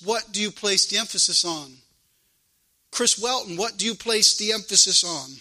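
usual speaking voice

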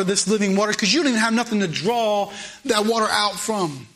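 how can you, she keeps pressing; incredulous woman. Retold or imitated. Woman at the well doubting Jesus, incredulous and pressing. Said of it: "She looks at him and says".

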